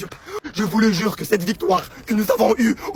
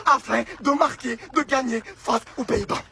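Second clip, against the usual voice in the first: ordinary delivery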